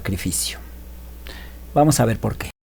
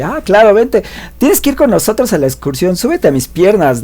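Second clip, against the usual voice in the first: teasing blonde; 7 semitones higher than usual. Retold or imitated. Blonde teasing him as a child.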